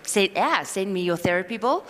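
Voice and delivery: ordinary delivery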